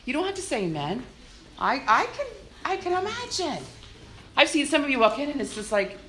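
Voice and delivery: usual speaking voice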